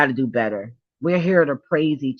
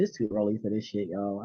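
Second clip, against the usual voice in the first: calming herself down; 6 semitones lower, 9 dB quieter than usual. Real, not acted. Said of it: "I gotta calm down".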